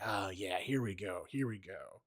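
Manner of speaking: lightly voiced villain, low and soft; a hint of a menacing voice, dropped low and quiet; Joker. Co-host imagines the actor at the mirror, mock Joker voice. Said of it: "doing his Joker voice".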